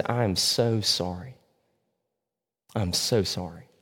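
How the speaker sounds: soft, slow, fading shamed apology; ashamed apology. Retold or imitated. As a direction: murmur it slowly, head hung in shame. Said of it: "looks at the people in shame".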